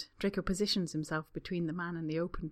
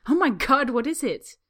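much higher and much louder, panicky; startled guest crying out in panic; alarmed cry; 7 semitones higher, 13 dB louder than usual. Acted. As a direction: shriek it in alarm. Panic at the spa reception.

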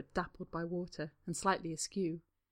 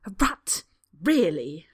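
hissing; 10 dB louder, slower than usual. Acted. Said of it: "Draco hisses".

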